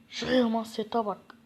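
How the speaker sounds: normal talking voice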